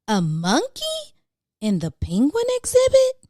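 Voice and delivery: disbelieving, voice shooting up; shocked question. Acted. Aquarium staff find a monkey among penguins, leaping up in shock.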